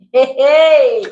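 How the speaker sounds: loud laughing hey hey; laughing exclaim. Real, not acted. Wife teasing about husband's voice.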